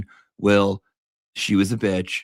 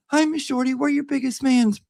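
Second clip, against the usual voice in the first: gushing fan voice. Retold or imitated. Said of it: "we're like".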